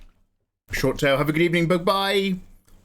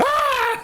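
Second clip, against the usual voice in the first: mock scream. Imitating streaming screams late at night.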